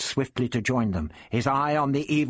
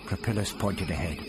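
plain reading voice

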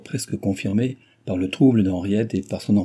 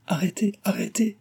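raised-pitch cry to stop; 12 semitones higher than usual. A narrator's reading. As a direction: cut in with a high plea. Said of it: "s'écria Cécile".

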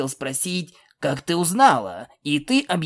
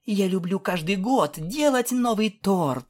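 mildly stoic, in a higher princess voice; 6 semitones higher than usual. A narrator's reading. Princess explains her yearly cake habit, stoic. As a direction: state it steadily, lightly in character. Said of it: "стоически сказала Селестия".

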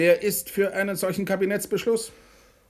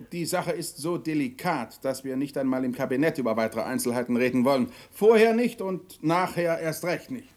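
normal talking voice

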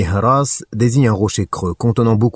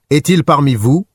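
higher, louder call across the valley; 6 semitones higher, 4 dB louder than usual. A narrator's reading. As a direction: shout down from the mountaintop. Abu Sufyan calls from mountain. (appel lancé plus haut et plus fort)

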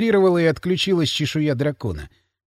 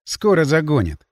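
ordinary delivery, nothing marked

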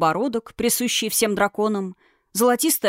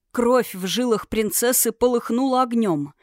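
usual speaking voice

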